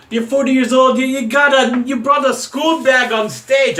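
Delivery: high, loud, hammed-up Irish accent; a bad Irish impression, mocking the rival. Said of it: "I don't do an Irish accent".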